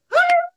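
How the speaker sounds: high mock shriek of a startled woman; a sudden high yelp; imitated scream. Pastor imitates a woman shrieking mid-sermon, mock shriek.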